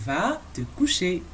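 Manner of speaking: "Va te coucher" spoken slowly, words drawn out; slow dictation of "Va te coucher"; slow model. Shown as a demonstration. Teacher models the bedtime command for learners, slowly.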